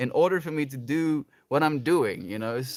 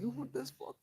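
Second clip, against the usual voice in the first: mock outrage; 13 semitones higher than usual. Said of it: "I was like".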